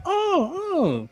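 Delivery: mock someone faking that they get it; fake knowing. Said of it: "pretending like they know what you're talking about".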